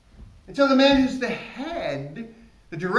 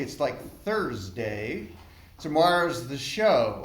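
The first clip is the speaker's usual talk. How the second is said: slow deadpan; 7 semitones lower, 3 dB quieter, slower than usual. Real, not acted. Deadpan reveal.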